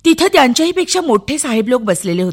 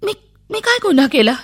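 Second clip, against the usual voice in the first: put-on frightened child; 6 semitones higher than usual. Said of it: "मी घाबरून गेले".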